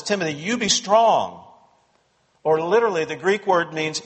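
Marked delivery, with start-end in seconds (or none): 0.0-1.4 higher, emphatic command with weight on "strong"; firm. Retold or imitated. Paul needs to speak firmly to Timothy, firm. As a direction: urge him firmly, as a mentor would. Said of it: "Paul needs to speak firmly to him".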